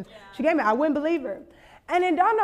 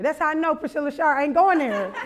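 ordinary talk

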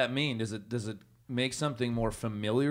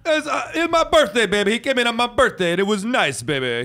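high, loud brash sports host voice; Mad Dog impression; 8 semitones higher, 5 dB louder than usual. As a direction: keep the squeaky loud radio impression going. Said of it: "do an impression".

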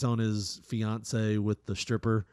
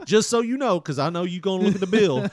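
put-on higher, louder rushed excuses to his girl; mock frantic boyfriend, pitched way up; frantic explaining; 8 semitones higher, 8 dB louder than usual. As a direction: cover your tracks in a panic. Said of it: "he's like".

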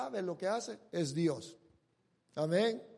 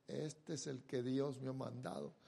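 low, much softer, a hint of reverent relief; quiet relief; 7 semitones lower, 10 dB quieter than usual. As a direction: say it hushed, to yourself. Abraham finds the ram.

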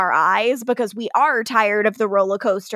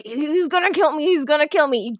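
mock fearful; 4 semitones higher than usual. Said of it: "Catherine's like".

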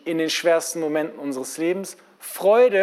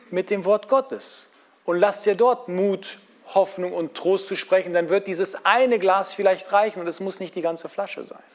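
usual speaking voice

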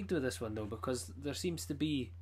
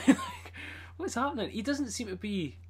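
laughing; 8 semitones higher, 3 dB louder than usual. Real, not acted. Laughing at confusing comic.

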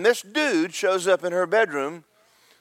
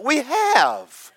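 high, hammed-up exasperated answer; exasperated reply; 8 semitones higher, 4 dB louder than usual. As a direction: wail it back in mock exasperation. Weary rowers answer Jesus back.

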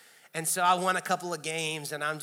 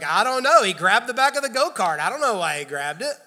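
mock innocent brother; 4 semitones higher, 9 dB louder than usual. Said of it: "And Jeremy's like".

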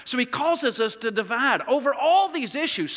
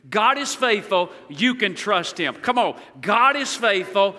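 slow call and response; slower than usual. Shown as a demonstration. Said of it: "Would you say that with me?".

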